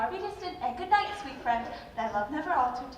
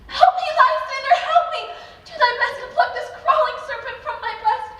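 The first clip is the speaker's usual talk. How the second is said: high, very loud panicked cries for help; terrified screaming; 11 semitones higher, 10 dB louder than usual. Acted. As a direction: scream for Lysander as if the snake is still on you. Hermia wakes from a nightmare.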